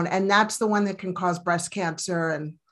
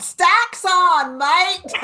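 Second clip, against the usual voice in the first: high, loud mock Aussie cheer; playful Aussie shout; 10 semitones higher, 6 dB louder, much slower than usual. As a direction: belt it like a cheeky Aussie bloke. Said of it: "I love that".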